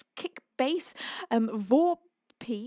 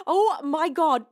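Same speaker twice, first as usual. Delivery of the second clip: ordinary talk, nothing marked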